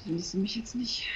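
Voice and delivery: hushed breathy whisper. Real, not acted. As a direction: whisper while sneaking. Sneaking in horror game.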